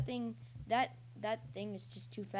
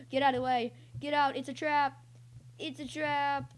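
higher, louder mock-urgent warning; playful alarm; 5 semitones higher, 5 dB louder than usual. Player warning it's a trap, playful alarm. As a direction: call out a warning in playful panic.

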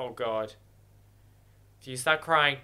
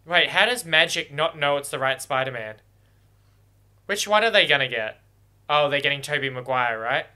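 ordinary talk

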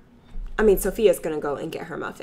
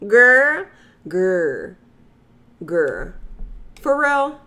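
put-on sassy girl; much slower than usual. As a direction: call her out with attitude. Sassy scolding.

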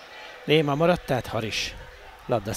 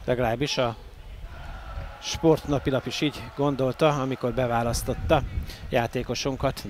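ordinary delivery

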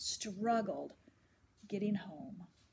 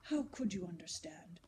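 low, soft, half-whispered; gently; 5 semitones lower, 4 dB quieter than usual. Acted. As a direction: ease off into tenderness. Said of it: "he said more gently".

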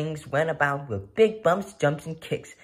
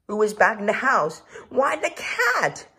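surprised reveal; 8 semitones higher, 5 dB louder than usual. A narrator's reading. Narrator revealing the cat has come back, surprised. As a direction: announce the return with delight.

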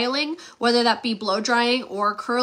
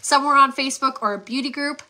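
normal talking voice

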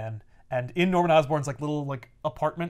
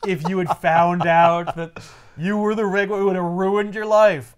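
slow, louder mocking villain; gloating villain; 3 semitones higher, 6 dB louder than usual. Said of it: "And he goes".

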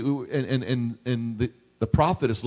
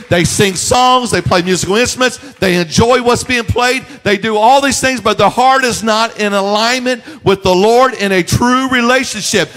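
loud, rising preacher's cry; full-force preaching; 4 semitones higher, 6 dB louder than usual. Real, not acted. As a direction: build the sermon to a loud peak.

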